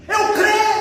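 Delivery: high, loud exclamation of faith; mock a father's desperate cry out; tearful cry, imitated. Father answering Jesus about his son, imitated tearful cry. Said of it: "Ele gritou com lágrimas".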